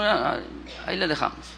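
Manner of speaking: usual speaking voice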